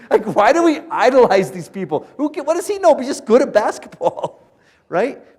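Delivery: high, loud exasperated disbelief; worked-up comic outrage, voice way up; incredulous rant. Real, not acted. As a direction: cry out at the absurdity. Ranting against idolizing athletes.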